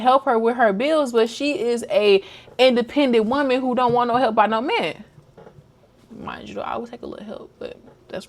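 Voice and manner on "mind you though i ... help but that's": whispered aside. Real, not acted. Aside about wanting help.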